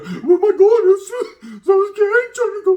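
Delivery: high squeaky panicked falsetto, laughing; mock scared inmate. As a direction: ham up a terrified guy begging to leave. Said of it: "as soon as they hit that sally port, they're".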